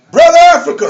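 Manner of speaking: high, hearty call across a room; hailing call. Retold or imitated. Preacher greets brothers in the club, hailing call. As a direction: hail a brother as you walk in.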